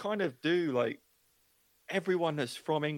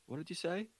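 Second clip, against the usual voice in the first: imitated puzzled wife; 6 semitones higher, 7 dB quieter than usual. Puzzled wife quoted asking.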